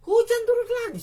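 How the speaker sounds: sudden high loud outburst; exasperated outburst. Real, not acted. Preacher telling the rain came back, high loud exasperation. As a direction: shoot the voice way up in disbelief. Rain returns after gluing.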